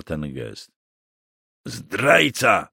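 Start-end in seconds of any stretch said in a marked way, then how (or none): 1.7-2.7 strained, raised-pitch rasp of accusation; hoarse, bitter outcry. A narrator's reading. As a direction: rasp it out as a wounded captive's curse. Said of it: "wycharczał Kruk".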